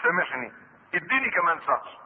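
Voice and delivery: low, soft plea of a hard-up borrower; debtor pleading for time. Acted. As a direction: beg quietly for another month, voice dropped. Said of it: "وقال لك".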